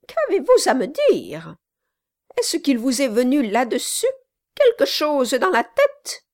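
high, loud, scornful tirade; furious spinster. Acted. Sally Brass rails at the notary, high and furious.

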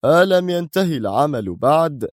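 stern, haughty. Acted. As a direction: demand it from above, like an arrogant boss. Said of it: "بلهجة صارمة متعالية". (بلهجة صارمة متعالية)

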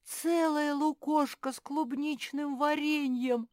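high, loud, slow exclamation; overjoyed bear cub, savouring every word; thrilled amazement. Acted. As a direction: marvel at a wonderful gift. Bear cub amazed by gift of jam.

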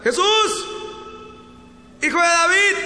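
high, drawn-out pleading cry; acted yell for attention; shouting. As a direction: call out like a blind beggar over a crowd. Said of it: "empezó a gritar".

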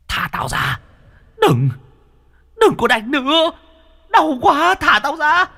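a beaten ghost screaming to be freed; howling demon. A narrator's reading. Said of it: "Nó cay đắng gào lên".

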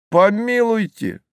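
high-pitched indignant exclamation; fool's protesting outcry; exclaiming. A narrator's reading. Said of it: "воскликнул дурак".

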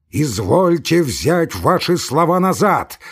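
indignant shout. Acted. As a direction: demand a retraction. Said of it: "крикнул Самойленко".

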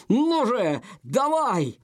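high, strained urging; will her back to life; desperate urging. Acted.

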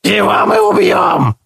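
high shouted creature chant; creature chorus. A narrator's reading. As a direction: chant it back as a mob. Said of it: "подхватил хор голосов".

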